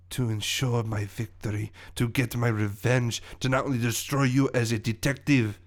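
villain monologue in a put-on French accent; accented villain revealing his master plan. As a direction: savour your revenge scheme, with pauses.

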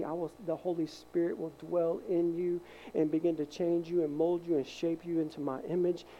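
ordinary talk

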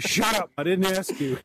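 high, loud, mock-angry shut up; snappy retort. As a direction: bite back, mock-offended. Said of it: "I'm like".

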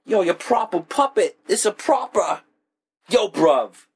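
hammed-up London accent, high and bright; British slang. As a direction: toss off a jokey British street voice. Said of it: "Like, proper".

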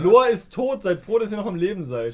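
high, loud telling-off; loud scolding. Acted. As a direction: snap at them to be grateful. Scolding the whining party.

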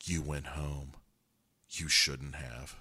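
lightly voiced, quiet and reproachful; soft quoted warning. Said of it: "And then she says".

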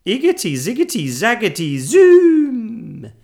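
high, loud incantation; dramatic spell-casting with a big finish; witch's spell. A narrator's reading. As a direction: chant the magic words, soaring on the last.

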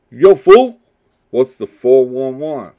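high loud street-slang voice. Retold or imitated. Podcaster doing the nineties voice asking for the latest gossip. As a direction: greet a friend loudly asking for gossip. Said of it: "you do this voice".

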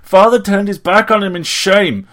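high, very loud tirade; furious, shrill full-voiced rant; angry shouting. A narrator's reading. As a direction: shout it shrilly, losing control. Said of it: "He loathed his shouting voice".

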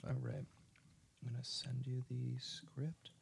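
murmured aside while busy; whispered. Real, not acted. Aside while sorting the script.

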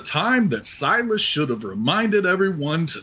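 grand, swooping, drawn-out declamation; hammed-up yelled proclamation. Player voices an animated aquatic gentleman protesting, pompous mock proclamation. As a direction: declaim it pompously with rising and falling pitch. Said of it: "it just yells out".

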